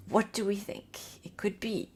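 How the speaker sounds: hushed, breathy question; whispering. Real, not acted. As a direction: whisper it like a secret.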